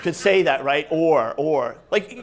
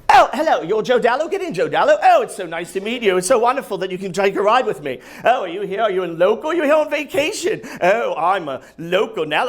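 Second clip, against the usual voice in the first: effusive Uber driver in a put-on British accent; chatty British accent. British-accented driver greeting his passenger, chatty and delighted. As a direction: gush non-stop in a mock British accent. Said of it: "he had a British accent".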